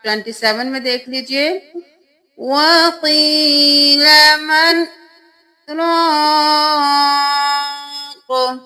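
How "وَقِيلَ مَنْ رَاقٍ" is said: slow chant with a brief breathless pause after مَنْ; Quranic recitation with sakta. Shown as a demonstration. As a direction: stop after مَنْ without taking breath. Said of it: "یہاں پہ سکتہ ہوگا".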